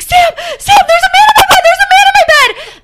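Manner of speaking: high, loud, frantic screaming; imitating a terrified roommate yelling for help; panicked scream. Roommate bursts in at night, screaming in panic. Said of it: "bursts into my room screaming bloody murder".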